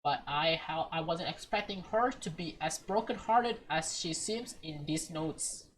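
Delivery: usual speaking voice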